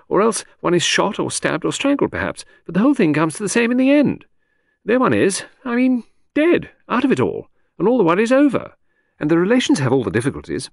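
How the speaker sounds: breezy chatter that floats from topic to topic; Lucy, lady of the house. Acted. Chatting to her husband in the garden, airy high lady. Lucy rambling on about death.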